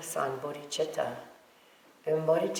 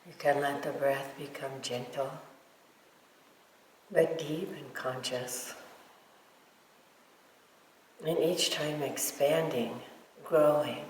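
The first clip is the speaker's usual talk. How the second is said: guided meditation. Real, not acted. Teacher guiding meditators to feel love for all beings, guided meditation. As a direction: lead them gently, leaving silence between phrases.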